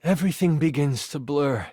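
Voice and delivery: grave storyteller, dropping low at the end; solemn death narration. A narrator's reading. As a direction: narrate a hero's death with long pauses. Game master narrates the player's death.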